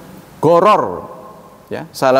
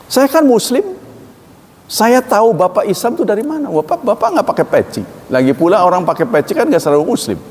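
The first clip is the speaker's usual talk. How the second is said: indignant argument; 7 semitones higher than usual. Retold or imitated. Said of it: "Saya bilang begitu".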